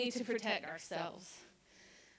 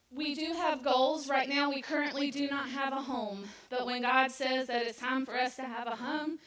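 ordinary talk

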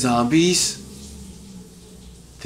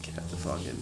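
imitating mom; 10 semitones lower, 14 dB quieter than usual. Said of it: "my mom would just be like".

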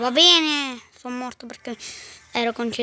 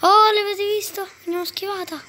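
excited; 7 semitones higher, 5 dB louder than usual. Real, not acted. Gaming excitement, dodged attack.

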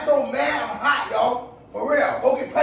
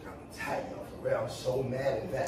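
very soft, low grumbling; sulky muttering; 12 semitones lower, 12 dB quieter than usual. Real, not acted. Upset man stews over his ex's pregnancy, sulky muttering. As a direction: mumble the grievance under your breath. Sulking after being yelled at.

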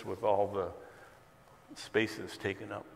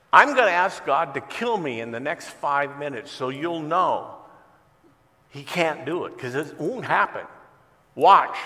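loud and higher, a mocking public challenge; taunting crowd; 4 semitones higher, 9 dB louder than usual. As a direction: dare the crowd, building to a shout on watch. Atheist taunting crowd at market.

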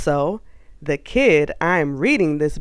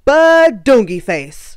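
shouted; 5 semitones higher, 7 dB louder than usual. Acted. Silly words shouted.

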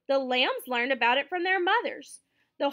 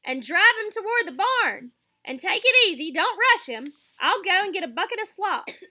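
raised voice, lightly acted farmer's orders; calling out orders; 5 semitones higher, 6 dB louder than usual. A narrator's reading. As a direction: direct the hired man in a raised voice. Farmer calls orders to hired man.

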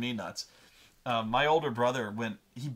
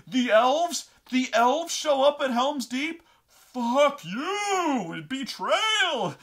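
high, loud, outraged disbelief building to screams; hammed-up furious shrieking; red rage; 11 semitones higher, 7 dB louder, much slower than usual. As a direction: imitate a betrayed fan screaming. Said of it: "going into the Red Rage".

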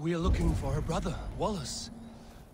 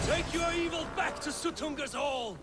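angry; 10 semitones higher than usual. Acted. Eivor confronts the vanished elk.

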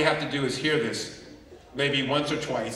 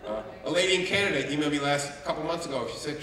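normal talking voice

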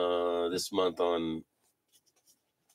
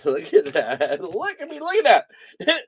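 laughing amazement; 7 semitones higher, 4 dB louder than usual. Real, not acted. Laughing at a card.

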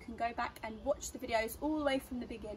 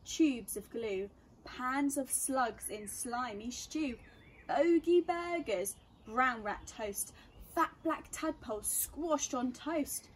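put-on reading to children; 3 dB louder, slower than usual. A narrator's reading. Reads a rhyming story aloud.

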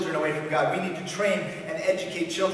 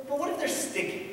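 much higher-pitched mock protest; worried objector; 11 semitones higher than usual. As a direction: raise a fussy objection. Voices an objecting church member.

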